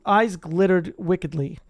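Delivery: plain reading voice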